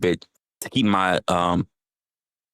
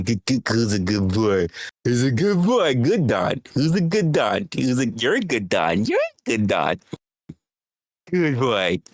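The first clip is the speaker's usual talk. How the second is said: pet-talk voice; 3 semitones higher, slower than usual. Acted. Praising a pet named Don.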